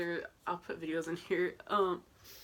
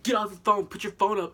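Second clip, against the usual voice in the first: much louder imitated guard command; mock security yell; 3 semitones higher, 11 dB louder than usual. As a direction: bark it like a stern guard. Security guard yelling at her.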